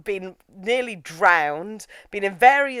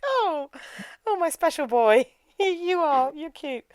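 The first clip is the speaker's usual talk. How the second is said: high-pitched adoring coo; doting coo; 8 semitones higher, slower than usual. Retold or imitated. Roberto to his special boy, doting mock coo. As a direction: gush fondly with a little laugh.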